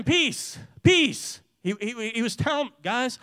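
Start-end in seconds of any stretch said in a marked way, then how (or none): 0.0-1.4 high repeated cry, louder on the second; yelled again. Retold or imitated. Dad yelling to his son again, high and building. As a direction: call it out twice, building. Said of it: "he yelled it again".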